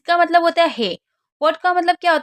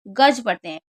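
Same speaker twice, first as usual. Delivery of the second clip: "गज" dropped low and firm; answer stressed; 5 semitones lower than usual. Shown as a demonstration. Teacher answers her own question, stressing "गज". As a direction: land "गज" with a firm fall. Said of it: "तो इसको क्या पढ़ते हैं?".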